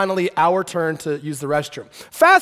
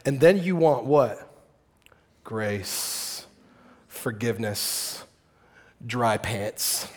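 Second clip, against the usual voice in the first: sincere hush; 10 semitones lower, 7 dB quieter, slower than usual. Real, not acted. Sermon turns to grace.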